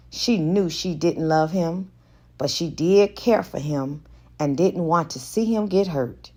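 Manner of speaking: usual speaking voice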